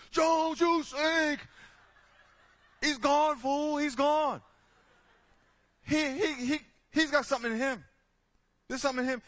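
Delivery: mock heckler yell. Heckler yelling again next game.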